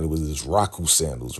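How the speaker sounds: normal talking voice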